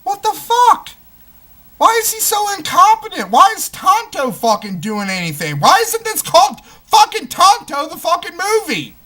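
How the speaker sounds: squeaky mock outrage of an old fan; outraged fan. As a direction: play a betrayed old fan yelling at the screen. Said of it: "they're just gonna be like".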